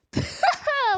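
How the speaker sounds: sudden high, loud burst of laughter; real laugh. Real, not acted. Player breaking up at their own song, laughing.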